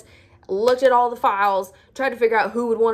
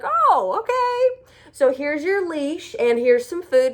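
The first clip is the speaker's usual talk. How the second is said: put-on breezy shelter girl; 7 semitones higher, 4 dB louder, slower than usual. Shelter girl brushing her off.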